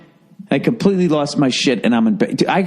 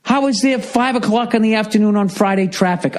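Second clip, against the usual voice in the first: exasperated disbelief; 8 semitones higher than usual. Retold or imitated. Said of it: "I'm like".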